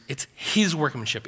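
"his" stands out before "workmanship"; emphatic "his", then let "workmanship" fall away; stress on his. Shown as a demonstration. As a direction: lean on the word "his". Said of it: "the emphasis there is".

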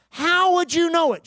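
high, pressing retold question; insistent questioning. Retold insistent repeat of easy question.

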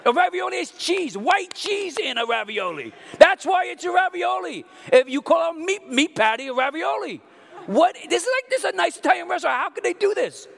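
high, loud, fast indignant tirade; comic outraged rant. Retold or imitated. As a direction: rail against the restaurant, mock-furious. Ranting at restaurant, shouting.

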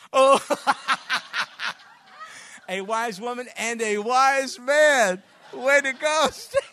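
laughing loudly while teasing; real laughter. Real, not acted. Preacher laughs at Stan's heckle from the pews. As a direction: crack up at a friend's joke mid-sermon.

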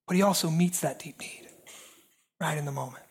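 hushed. Acted. Hushed close after climax.

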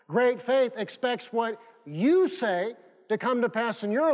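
slow, deliberate preaching; slow emphatic. Acted. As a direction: weigh every phrase.

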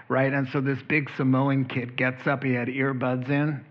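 ordinary talk

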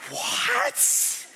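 gasp, then a high, loud mock-shocked cry; hammed-up child's outrage; shocked outburst. Kids denied ice cream at Chick-fil-A, hammed-up shock.

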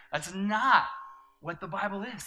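loud, high rebuttal from the pulpit; forceful preaching. Acted. Pastor rejecting the Bible acronym before the church, forceful. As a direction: slam the door on that idea.

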